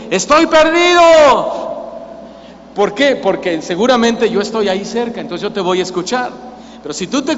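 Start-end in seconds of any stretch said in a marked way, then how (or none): 0.1-1.7 put-on high, drawn-out yell of a lost child; loud shout. Father shows his children how to yell when lost, loud shout. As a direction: shout it long and high so a parent hears. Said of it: "grita fuerte".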